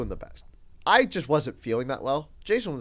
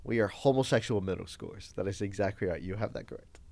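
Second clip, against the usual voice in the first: deadpan sarcasm; 7 semitones lower, 6 dB quieter than usual. Retold or imitated. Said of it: "I'm like".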